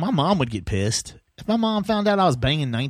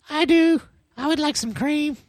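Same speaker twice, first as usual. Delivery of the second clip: much higher squeaky put-on voice; high falsetto reply; 13 semitones higher than usual. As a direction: answer in a silly high voice.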